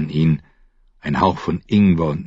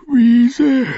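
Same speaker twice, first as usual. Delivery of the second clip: yawned, much higher; yawning; 6 dB louder than usual. Acted. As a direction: say it through a yawn. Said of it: "gähnte sie".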